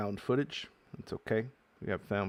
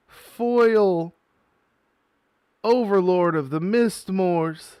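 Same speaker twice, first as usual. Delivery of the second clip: loud, high, thrilled yelling; excited shouting; 7 semitones higher, 9 dB louder than usual. Real, not acted. Opening a pack for viewers, shouting loud and thrilled. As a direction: shout it out like a jackpot win. Pulls rare Japanese foil card.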